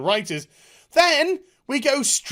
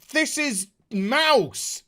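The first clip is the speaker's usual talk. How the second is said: normal talking voice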